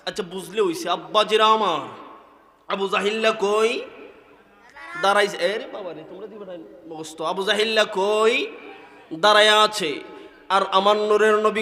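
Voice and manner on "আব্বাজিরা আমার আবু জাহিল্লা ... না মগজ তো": usual speaking voice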